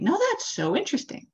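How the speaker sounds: the preacher thrilled by a new idea; bright-eyed, rising wonder at the passage; excited discovery. Retold or imitated. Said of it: "And I was like".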